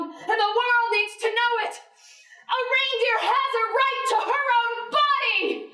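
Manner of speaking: high-pitched, impassioned outrage; indignant protest. Acted. Reindeer demanding rights over her own body, impassioned high protest. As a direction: protest it like a rally speech, pitched high throughout.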